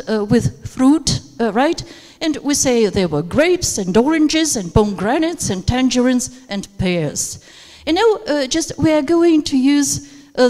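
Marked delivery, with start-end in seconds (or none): 2.9-6.3 rising tone on "grapes", "oranges", "pomegranates", "tangerines"; rising list intonation. Shown as a demonstration. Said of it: "rising tone and falling tone at the end". 6.5-7.4 "pears" drops low to close the list; falling final tone. Shown as a demonstration. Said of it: "falling tone at the end".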